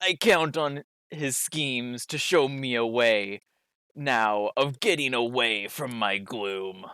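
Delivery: ordinary talk, nothing marked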